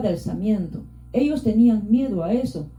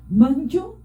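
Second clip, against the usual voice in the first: defiant shout; 6 semitones higher than usual. A narrator's reading. Don Gualpa shouts defiance at don Vilcas in front of everyone. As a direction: hurl the challenge across the crowd. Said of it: "gritó desafiando".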